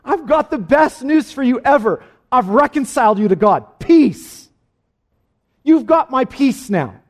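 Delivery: high, loud burst of glad news; joyful proclamation. Acted. Risen Jesus greeting his disciples with the best news, joyfully proclaimed. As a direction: announce it loudly with a big smile. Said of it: "Jesus had a big smile on his face".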